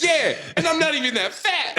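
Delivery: high-pitched laughing protest; mock indignation. Acted indignant reply, while laughing.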